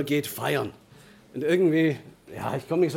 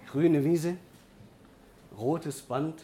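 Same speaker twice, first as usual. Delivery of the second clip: normal talking voice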